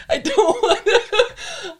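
high, drawn-out words through laughter; helpless giggly delivery; laughing. Real, not acted. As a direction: squeeze the words out while cracking up. Cracking up over offending friend.

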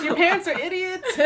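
high, laughing jab; laughing mock insult. Joking at kids' parents, high and laughing. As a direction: laugh through a cheeky insult. Joke quote to sad kids.

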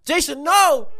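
high, loud hammy "no"; mock dramatic cry. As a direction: ham up a movie-death scream. Acting out a movie death scene.

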